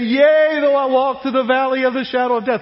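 high, loud, gleeful cheer on the verse; misplaced excited cheer. Acted. Preacher mocking his childhood reading of the psalm, excited yell. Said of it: "yay was something you yelled".

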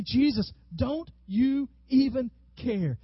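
loud, high, slow exasperated plea; pleading question. Acted. Disciples to Jesus in the storm, exasperated plea. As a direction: stretch it out as a desperate challenge. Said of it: "look at their question".

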